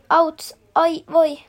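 loud, slow ouch; a hammed-up storybook yelp; loud slow mock cry of pain from the bear. A narrator's reading. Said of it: "tohtori Karhu kiljuu".

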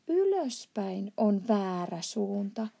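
ordinary delivery, nothing marked

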